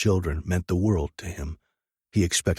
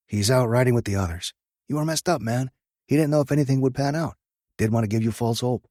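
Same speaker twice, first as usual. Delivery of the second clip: lightly voiced, higher biker buddy; a hint of an urgent friend; 6 semitones higher, faster than usual. Track's reassuring reply.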